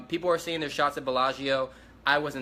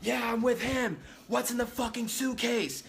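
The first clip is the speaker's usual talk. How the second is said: much higher put-on voice, demanding; retold stranger butting in, voiced much higher; other guy's voice; 9 semitones higher than usual. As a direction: pitch way up to play the pushy stranger. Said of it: "another guy runs up, he goes".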